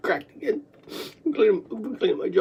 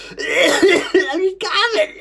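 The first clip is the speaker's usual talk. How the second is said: laughing; 8 semitones higher, 4 dB louder, much slower than usual. Real, not acted. Laugh while coughing.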